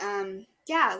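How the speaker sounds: usual speaking voice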